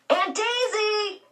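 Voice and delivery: high, drawn-out call up from the crow's nest; yelled. A narrator's reading. Boy shouting down from the crow's nest, high calling yell. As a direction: call her name from way up high. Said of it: "he'd yelled".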